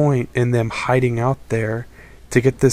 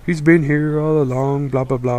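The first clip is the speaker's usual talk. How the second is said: mocking quote; 3 semitones higher than usual. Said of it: "they're just saying".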